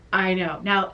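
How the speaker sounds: normal talking voice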